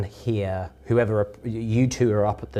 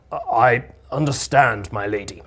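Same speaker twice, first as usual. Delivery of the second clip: gruff and weary. Acted. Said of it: "is gruff and weary".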